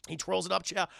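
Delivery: ordinary delivery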